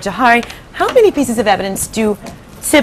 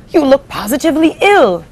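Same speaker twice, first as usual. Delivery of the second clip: put-on higher, fussy old grasshopper; 6 semitones higher than usual. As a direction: fret over him like an old bug. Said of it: "the old green grasshopper said".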